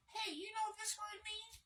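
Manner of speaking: very high, squeaky put-on voice of a know-it-all; mocking quote. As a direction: pipe it up high like a smug fool. Mocks people using the new word.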